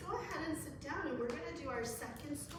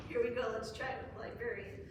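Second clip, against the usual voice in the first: usual speaking voice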